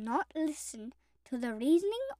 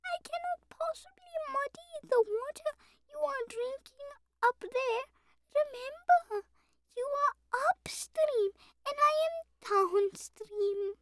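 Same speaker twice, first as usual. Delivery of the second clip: timid, very high-pitched lamb voice; trembling lamb; 13 semitones higher than usual. A narrator's reading. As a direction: explain meekly in a tiny high voice. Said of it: "replied the trembling lamb".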